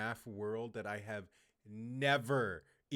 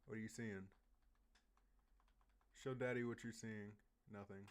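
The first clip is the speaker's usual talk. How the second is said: much softer, under the breath; murmured coaxing; 12 dB quieter than usual. Pretend. Murmurs to game character.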